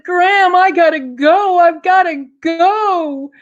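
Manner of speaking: put-on high, loud, pleading boy's voice; anxious little boy. Boy pleading with his grandma to let him go. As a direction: beg to get away, urgent.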